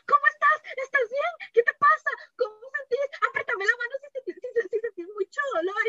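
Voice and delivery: high, frantic string of questions; imitated panic. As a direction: fuss in a high voice like a panicking partner. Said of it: "Así panicking, manejando al hospital".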